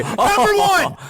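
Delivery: high, loud game-show announcer. Pretend. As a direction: announce it like a countdown host. Countdown list announcement.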